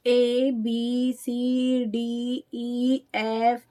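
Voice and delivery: ए, बी, सी recited as drawn-out alphabet names, softly; slow ABC recital, each of ए, बी, सी stretched; letter names. Shown as a demonstration. Teacher showing how children should not recite, ए, बी, सी as letter names. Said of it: "ऐसे नहीं बताएं".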